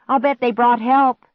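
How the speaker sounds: high, loud and hopeful; excited shout. A narrator's reading. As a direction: call out hopefully. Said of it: "Clark shouted".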